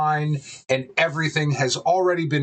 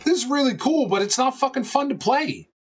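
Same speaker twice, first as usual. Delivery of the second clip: quoting friend, high voice; 11 semitones higher than usual. Retold or imitated. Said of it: "where you were like".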